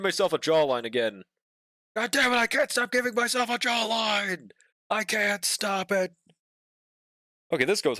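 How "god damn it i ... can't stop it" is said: high, mock-despairing cry; frustrated exclaim. Real, not acted. Artist mock-wails at drawing a jawline again. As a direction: throw up your hands in mock despair.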